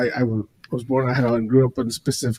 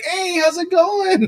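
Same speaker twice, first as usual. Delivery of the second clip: high, slow, drawn-out cheery greeting; mock friendly local; slower than usual. Retelling locals answering his honk, hammed-up friendly holler. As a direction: imitate an overfriendly small-town driver, stretched out. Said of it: "you honk at them, they're like".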